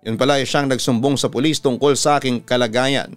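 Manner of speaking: usual speaking voice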